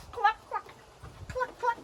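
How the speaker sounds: high clucking hen; chicken clucks. Shown as a demonstration. Teacher clucking like a chicken for the kids. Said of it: "cluck like a chicken".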